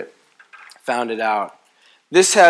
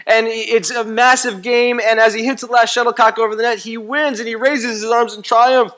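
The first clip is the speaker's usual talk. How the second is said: higher and louder, building hype; preacher hyping a TV sports story; dramatic build-up; 6 semitones higher, 3 dB louder than usual. Retold or imitated. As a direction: tell it like a breathless sportscaster. Dramatic sports-broadcast retelling.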